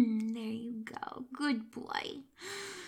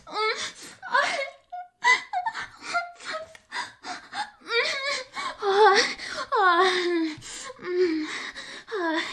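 sharp loud cry, much higher, then panting moans; loud orgasm cry; climax cry; 12 semitones higher, 5 dB louder, slower than usual. Acted. Acted climax peak.

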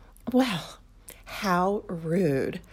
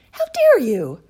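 high, loud outraged protest; be scandalised, loud; indignant; 5 semitones higher, 11 dB louder than usual. Acted. Indignant at being grabbed.

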